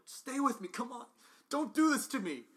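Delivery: loud, high anguished begging; desperate plea. Acted. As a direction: beg a dying friend to hang on. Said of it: "I go".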